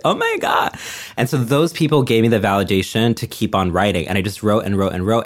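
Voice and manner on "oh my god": very high, squeaky mock astonishment; stunned disbelief. Poet recalling classmates' praise of the poems, stunned disbelief. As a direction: relive the shock in a tiny high-pitched voice.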